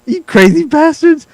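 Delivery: high, slow incredulous squeal; hammed-up shock at the sweater; mock disbelief. Mocking sweater wearers with squealing disbelief.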